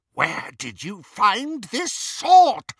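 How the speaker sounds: high, loud, slow demand; fierce interrogation. A narrator's reading. Bellatrix brandishing the sword at Greyback, fierce loud demand. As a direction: demand it loudly, drawing out each word.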